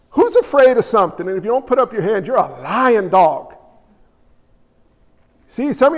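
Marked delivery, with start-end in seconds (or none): none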